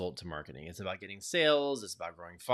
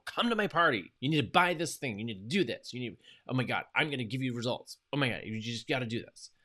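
mock yelling; 4 semitones higher, 4 dB louder than usual. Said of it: "they're yelling at people".

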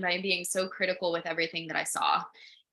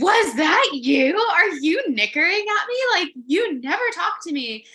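excited cooing; 9 semitones higher, 7 dB louder than usual. Retold or imitated. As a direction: talk to the horse like a thrilled friend. Cooing to the horse.

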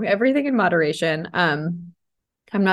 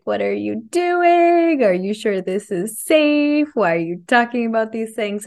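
put-on inner child; 4 semitones higher than usual. Inner child asks if sharing is safe, high and worried. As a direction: fret like a scared little kid. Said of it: "my inner child is like".

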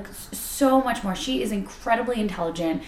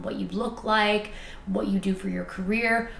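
ordinary talk, nothing marked

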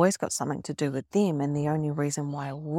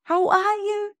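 high, chirpy imitation of an old friend; lightly voiced friend calling out a high hello; bubbly friend greeting; 13 semitones higher, 3 dB louder, slower than usual. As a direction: mimic a breezy friend popping back in, pitched up. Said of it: "she rolls into my life and she's like".

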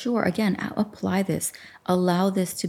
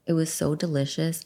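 ordinary delivery

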